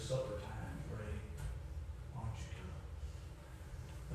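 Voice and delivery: very soft, low pleading; almost whisper the invitation; hushed invitation. Acted. Preacher voicing Christ calling from heaven, hushed invitation.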